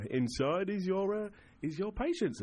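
mock game show reveal; announcing the patient.

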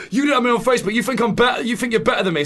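high, loud accusing whine; mock angry accusation. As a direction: whine it like an offended acquaintance. Imitating angry townspeople.

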